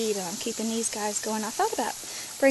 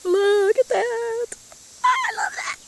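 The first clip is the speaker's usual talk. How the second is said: delighted; 11 semitones higher, 5 dB louder, slower than usual. Real, not acted. Delight at drooping flowers.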